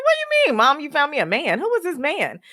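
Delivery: retelling a stunned reply to mom; incredulous. Retold or imitated. On the phone with mom, stunned, high-pitched disbelief. Retorting to mom's matchmaking news.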